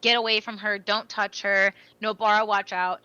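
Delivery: ordinary delivery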